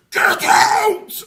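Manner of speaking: huge, high, shrieking demon voice; demonic scream. Retold or imitated. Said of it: "screams at him in this awful demonic voice".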